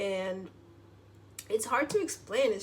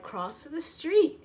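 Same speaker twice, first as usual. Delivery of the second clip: drawn-out inner voice; 7 semitones higher, 5 dB louder, much slower than usual. Retold or imitated. Gut feeling voiced as command.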